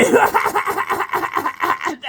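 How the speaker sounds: high, loud burst of laughter; shrieking laugh. Real, not acted. Laughing at Greg Wilson while warning a girl off him, shrieking.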